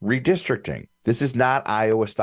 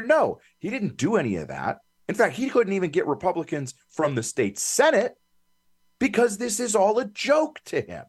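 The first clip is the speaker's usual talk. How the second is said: real indignant rant, pitched much higher; fired up; 12 semitones higher than usual. Real, not acted. Pundit rambling about the Speaker's bad faith, fired up. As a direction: get fired up, voice climbing high as you go. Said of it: "I'm getting fired up".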